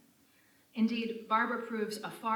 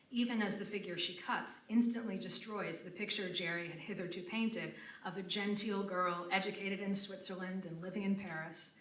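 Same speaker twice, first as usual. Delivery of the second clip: ordinary talk, nothing marked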